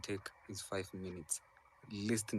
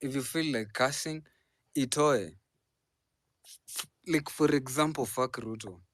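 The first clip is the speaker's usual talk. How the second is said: much louder and higher, fired up; loud heated outburst; 5 semitones higher, 11 dB louder than usual. Real, not acted. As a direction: shout the point across to the crowd. Host urges cursing, loud and heated.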